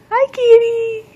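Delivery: high, singsong hello; pet-talk greeting. Real, not acted. Parent greeting Kita for baby, high singsong pet talk.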